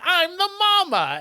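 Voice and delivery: very high, worked-up mother; mock insistent. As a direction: imitate a frantic mama announcing herself.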